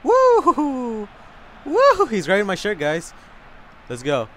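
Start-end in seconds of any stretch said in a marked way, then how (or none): 0.0-2.1 high, loud "woo!"; excited whoop. Real, not acted. Gamer cheering a play on the pitch, high whoop.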